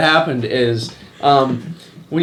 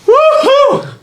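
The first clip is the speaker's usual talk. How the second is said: high loud whoop; cheering; 7 dB louder than usual. Real, not acted. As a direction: let out a big woohoo. Cheering after throw.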